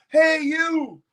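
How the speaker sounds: high, hammed-up indignant call; mock outrage. Singer faking outrage at the balcony photographer, high. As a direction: yell it in fake surprise.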